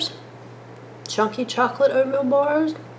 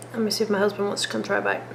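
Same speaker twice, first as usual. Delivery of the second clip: fast; 8 dB quieter, much faster than usual. Real, not acted. Calling husband to the kitchen.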